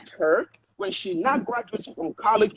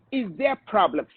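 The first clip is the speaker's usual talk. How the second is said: ordinary delivery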